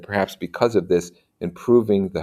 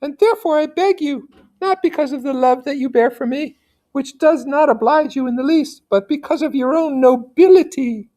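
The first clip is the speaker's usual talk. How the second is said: lady's pleading voice; 3 dB louder than usual. Acted. Teacher voicing the lady begging Federico, high and pleading. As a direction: beg him in a high, genteel lady's voice.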